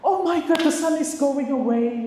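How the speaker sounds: high, loud mock amazement; dramatic kid talk. Shown as a demonstration. Teacher playing up to kids about the sun, high loud mock amazement. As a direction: gasp it out like a wide-eyed storyteller for a child. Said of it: "gitu kan, udah mulai meninggi".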